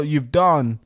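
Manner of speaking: normal talking voice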